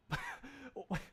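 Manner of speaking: high squeaky laugh. Real, not acted. Laughing at his misspelled title.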